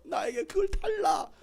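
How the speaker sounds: very high, drawn-out demand; insistent demanding. Acted. Mary demanding Jesus' body, high-pitched insistent cry. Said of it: "예수님의 시신을 막 달라고 하는".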